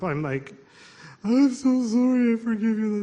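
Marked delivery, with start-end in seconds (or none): 1.2-3.0 high-pitched mock-weepy apology; tearful. Alone in the car forgiving people, exaggerated tearful voice. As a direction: imitate a tearful wail, pitched way up. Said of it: "I'm tearing up".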